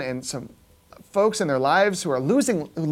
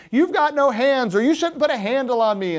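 mock complaining shout; 6 semitones higher, 4 dB louder than usual. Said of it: "shouting up and complaining and saying".

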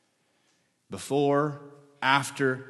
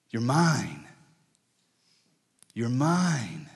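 gentle reassurance; much slower than usual. Acted. God reassuring.

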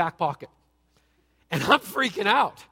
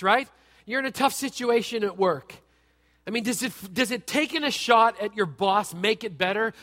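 normal talking voice

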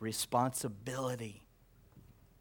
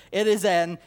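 ordinary delivery

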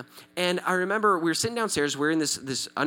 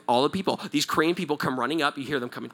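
usual speaking voice

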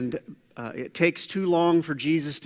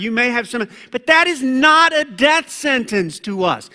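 higher, louder encouraging voice; voicing the Lord; 9 semitones higher, 9 dB louder than usual. The Lord encouraging a believer, earnest and raised. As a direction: speak as God reassuring His child. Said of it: "the Lord, who will say".

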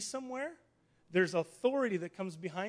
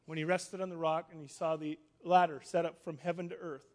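normal talking voice

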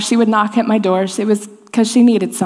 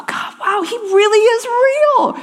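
much higher, slow, wondering; awed amazement; 13 semitones higher, slower than usual. Retold or imitated. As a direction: let the wonder catch in your voice. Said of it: "and go".

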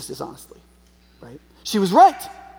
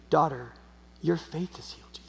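low, very soft, tender reassurance; tender Jesus; 5 semitones lower, 9 dB quieter than usual. Retold or imitated. Preacher voices Jesus to the healed woman, tenderly. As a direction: soften right down, as to a daughter. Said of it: "severity to daughter".

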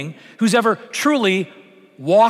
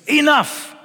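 a higher, louder exasperated mother cutting in; stern mom; 8 semitones higher, 3 dB louder than usual. Retold or imitated. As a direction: stop the fighting kids, mock-stern mom. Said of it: "the mom walks into the kitchen".

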